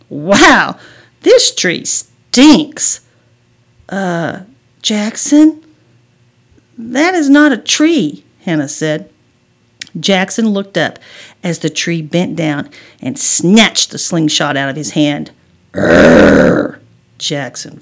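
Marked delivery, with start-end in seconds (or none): 0.1-3.0 disgusted. Acted. Boy bumping into smelly tree, disgusted. 3.9-8.2 slow, higher, hesitant voice; wary warning. Acted. Girl seeing Bigfoot behind brother, warily. 15.7-16.8 low, loud rumbling growl; beast growl. A narrator's reading. Bigfoot snatching the slingshot, growling.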